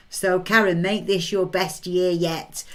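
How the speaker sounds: usual speaking voice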